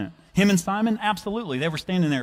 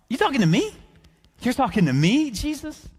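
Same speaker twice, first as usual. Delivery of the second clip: high, loud mock disbelief; incredulous; 11 semitones higher, 3 dB louder than usual. Simon asked for his boat by Jesus, incredulous. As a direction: question it as if singled out by mistake.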